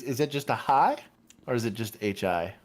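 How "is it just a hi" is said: HI lifted high in a rising question; excited hi. Shown as a demonstration. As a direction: ask with an upbeat, raised "hi". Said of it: "it's an excited hi".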